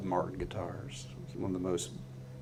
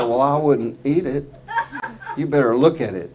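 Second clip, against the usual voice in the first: laughing; 5 semitones higher, 7 dB louder than usual. Retold or imitated. Woman warns him, laughing.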